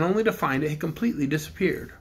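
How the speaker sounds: usual speaking voice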